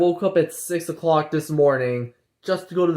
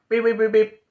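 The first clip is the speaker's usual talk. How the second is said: robot beeps; 7 semitones higher than usual. Retold or imitated. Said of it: "And I'm like".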